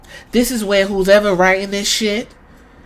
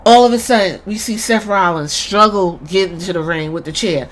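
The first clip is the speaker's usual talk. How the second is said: ordinary talk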